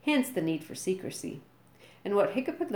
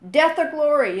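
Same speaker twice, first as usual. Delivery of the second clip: much higher, much louder battle cry; yelling; 10 semitones higher, 11 dB louder than usual. A narrator's reading. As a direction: roar it like a war chant. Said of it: "yelled Gobber".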